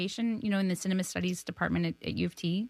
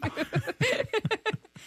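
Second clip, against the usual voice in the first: big laugh. Real, not acted. Laughing at own story.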